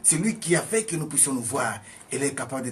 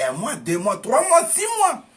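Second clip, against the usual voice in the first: triumphant count; 12 semitones higher, 9 dB louder than usual. Retold or imitated. Healed man to his doctor, rising triumphant shout. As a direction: voice climbs much higher and louder each month.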